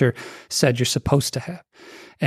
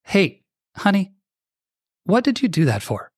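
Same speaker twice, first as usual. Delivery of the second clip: gentle, caring question; loving, curious; 4 semitones higher than usual. Shown as a demonstration. Husband asking his wife kindly what happened, tender. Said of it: "that mindset is gonna deliver completely differently".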